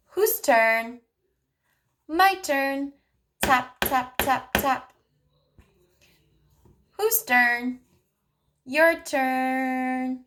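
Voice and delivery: put-on speech therapist modeling turn-taking for a child, sing-song. Shown as a demonstration. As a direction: model it slowly and playfully for a toddler. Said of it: "what you can do is you can say".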